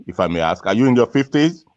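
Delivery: ordinary delivery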